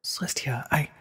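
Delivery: whispered, faltering; shaken whisper. A narrator's reading. Twilight backs away from Celestia, whispering in shock. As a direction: back away in a hushed stammer. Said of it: "backing away".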